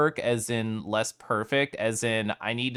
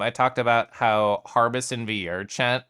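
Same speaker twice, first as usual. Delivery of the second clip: ordinary delivery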